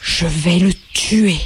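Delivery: slow, low spat-out threat; a hint of a battered rabbit snarling; spitting threat. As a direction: spit the words through dirty whiskers. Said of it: "en crachant à travers ses moustaches".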